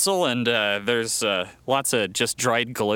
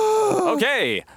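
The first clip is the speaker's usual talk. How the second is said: gasp of excitement. Acted. Excited to read the will.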